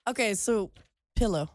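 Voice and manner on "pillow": pillow said carefully as "PILL-oh"; careful short I in pillow, lower pitch; practised pronunciation. Shown as a demonstration. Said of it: "Good work".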